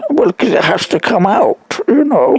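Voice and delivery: rising protest, voice climbing high; sharp, high-pitched complaint to the nurses; exasperated retort. Retold or imitated.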